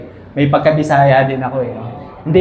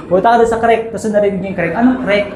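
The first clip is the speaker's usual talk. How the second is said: retold with a laugh; 5 semitones higher, faster than usual.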